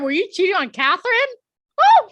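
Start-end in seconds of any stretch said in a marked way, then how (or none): 1.8-2.1 loud, high whoop of delight; excited whoop. Real, not acted. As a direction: whoop it up at the gossip. Cheering a scandal.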